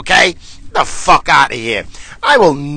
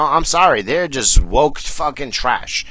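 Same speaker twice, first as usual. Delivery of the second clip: normal talking voice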